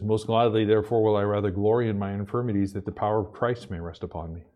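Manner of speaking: low, quiet, measured scripture reading. Acted. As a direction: read the verse softly and reverently. Reads scripture aloud.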